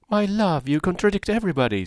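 high, quick, teasing chirp; laughing Mrs Palmer. Acted. Mrs Palmer teasing her contrary husband, high and quick. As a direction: tease him merrily. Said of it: "said his wife with her usual laugh".

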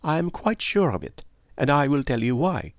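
high-pitched, assured detective; lightly voiced Poirot. Poirot promising to explain why, assured detective voice. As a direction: assure him in the accented detective's voice.

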